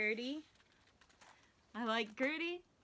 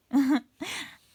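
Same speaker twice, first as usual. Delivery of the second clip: bright burst of laughter; short real laugh; 8 dB louder than usual. Real, not acted. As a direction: laugh at the wobbly circle. Laughing while drawing circle.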